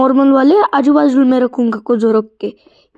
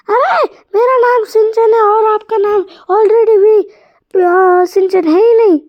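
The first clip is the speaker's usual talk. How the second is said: put-on high kid voice, crying and shouting; a little boy wailing over his game; whiny child voice; 8 semitones higher than usual. (ऊँची, रोती-चिल्लाती बच्चे की आवाज़)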